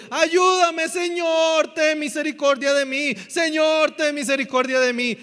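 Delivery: loud, high cry for mercy; desperate shouting. Acted. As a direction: scream after someone for help. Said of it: "iba gritando". (gritos de súplica)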